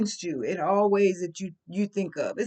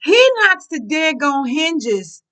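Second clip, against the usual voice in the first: louder, slower, hammering each word; emphatic outrage; 5 semitones higher, 8 dB louder, slower than usual. Real, not acted. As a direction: make them feel how outrageous it was.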